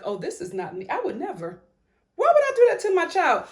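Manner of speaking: a lightly voiced God, building to an outraged question; mock God reacting. Vlogger playing God watching the show, building outrage. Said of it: "God is sitting down looking at this show like".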